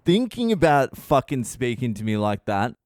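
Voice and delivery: normal talking voice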